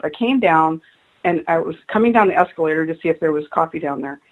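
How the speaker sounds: usual speaking voice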